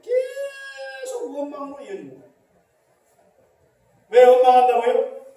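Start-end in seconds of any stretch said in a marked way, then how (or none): 0.0-2.3 preaching shout. Real, not acted. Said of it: "preacher shouts the point".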